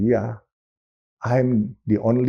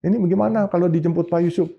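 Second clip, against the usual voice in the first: retold urgent asking; 8 semitones higher, faster than usual. Friend urgently asks his wife, retold.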